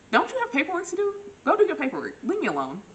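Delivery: high loud flustered brush-off; flustered shout. Retold or imitated. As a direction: shoo him off, loud and flustered. Flustered brush-off when he flirts.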